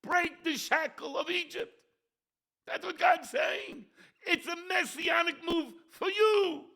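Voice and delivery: high, loud preacher's shout; fiery preaching. Acted. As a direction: thunder it from the pulpit. Rabbi preaching at full force.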